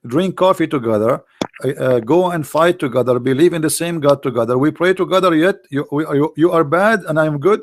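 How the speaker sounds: usual speaking voice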